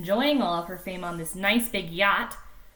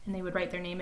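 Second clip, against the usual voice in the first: usual speaking voice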